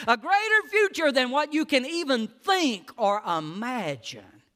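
fervent revival preaching; preaching at full force. Acted. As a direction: build the promise up to a shout. Preaching builds to a shout.

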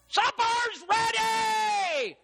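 high, long-drawn supper holler; mama yelling. Acted. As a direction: yell it slowly through the whole house. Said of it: "Oh, Mama yelled".

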